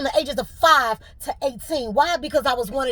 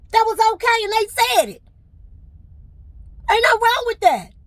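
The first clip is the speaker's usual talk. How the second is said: high, loud furious venting; furious rant; 9 semitones higher, 5 dB louder than usual. Real, not acted. Venting to viewers about unfair treatment, high and furious. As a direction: let the outrage pour out.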